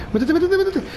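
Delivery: high, hurried pleading; frantic urging. Real, not acted. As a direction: urge them in, terrified. Urging character to hide mid-chase. (súplica frenética muy aguda)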